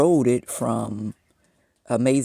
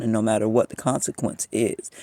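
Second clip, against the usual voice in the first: normal talking voice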